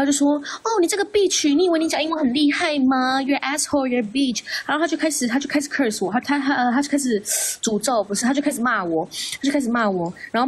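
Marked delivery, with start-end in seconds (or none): 0.6-4.4 higher, imitating someone blowing up; hammed-up rant mimicking the attacker; retold angry cursing; mock furious insult. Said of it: "然后他就开始发飙".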